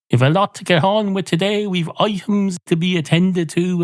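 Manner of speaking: a hint of a 1990s Irish radio host; old radio presenter. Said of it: "They say things like".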